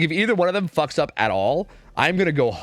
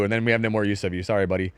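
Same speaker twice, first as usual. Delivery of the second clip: ordinary talk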